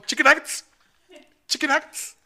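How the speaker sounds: high, loud goofy voice; silly voice. Pretend. Joking to his friend about an old running gag, in a high, loud goofy voice. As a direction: squeal it out like a joke catchphrase. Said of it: "un día de mame".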